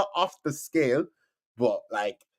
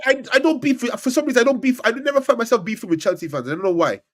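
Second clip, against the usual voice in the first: flustered protest; 8 semitones higher, 5 dB louder, faster than usual. Real, not acted. Flustered defending his ranking.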